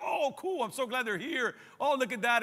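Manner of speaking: high, excited reaction to a cameo; delighted moviegoer. Acted. Viewer spotting a beloved movie character, delighted.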